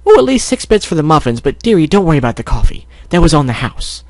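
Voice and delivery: high, kindly bakery lady; generous shopkeeper. Acted. Voices Mrs. Cake in story.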